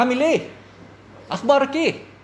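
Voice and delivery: high, punched Egyptian dialect; rough Egyptian Arabic. Shown as a demonstration.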